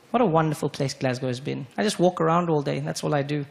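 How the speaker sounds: ordinary delivery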